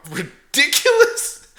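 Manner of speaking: ridiculous stretched high, slow and loud; emphatic mockery. Real, not acted. Host ridiculing the speedboat accident, stressing ridiculous. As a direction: lean hard on ridiculous in disbelief. Mocking the plot.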